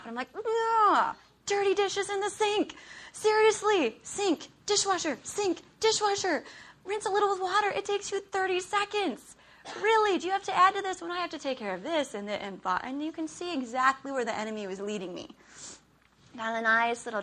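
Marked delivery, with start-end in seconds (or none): 0.3-12.1 high, loud exasperated rant; ticked off. Retold or imitated. Wife finding dirty dishes in the sink, ticked off. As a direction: hammer out each chore, fed up and shrill-pitched. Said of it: "that ticks me off".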